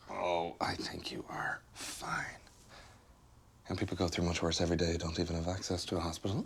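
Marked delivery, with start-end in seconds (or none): 0.1-2.2 slow, whispered brush-off; calm her down dismissively; soft dismissive hush. Acted. Boyfriend brushing off her hospital worry, slow hushed brush-off.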